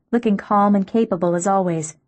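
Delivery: plain reading voice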